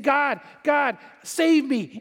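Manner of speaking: acted desperate plea; pleading for rescue. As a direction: call out to God to be saved. Sufferers crying out to God.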